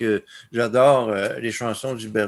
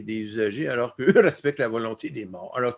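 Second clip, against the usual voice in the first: ordinary talk, nothing marked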